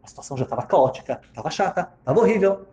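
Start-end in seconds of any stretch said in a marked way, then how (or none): none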